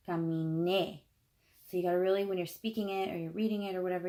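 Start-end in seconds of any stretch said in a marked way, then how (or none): 0.1-1.0 "caminé" with the emphasis on final é; teacher's demo of "caminé", é stressed. Shown as a demonstration. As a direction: land firmly on the last syllable of "caminé". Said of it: "it's on e".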